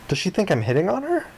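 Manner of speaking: usual speaking voice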